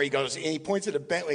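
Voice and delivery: ordinary delivery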